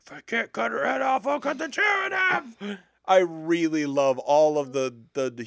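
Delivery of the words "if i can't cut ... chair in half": rising to a high shout; shouted movie quote. Retold or imitated. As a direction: bellow it like a raging character. Quoting movie character.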